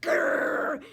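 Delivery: loud hammed-up growl; a princess growling at the king; angry growl. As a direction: growl like a cartoon grump. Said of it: "with a terrible temper".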